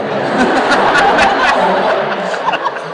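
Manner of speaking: loud high laugh rising; real laughter, high and loud; big laugh. Real, not acted. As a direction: laugh hard at the memory. Laughs retelling the scene.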